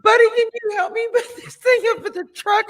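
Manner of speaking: fast, loud, laughing quote. Retold or imitated. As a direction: rush the request while laughing. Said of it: "He's like".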